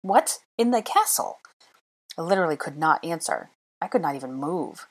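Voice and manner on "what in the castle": high-pitched disbelieving questions; incredulous. A narrator's reading. Hermione hearing of goblins, incredulous.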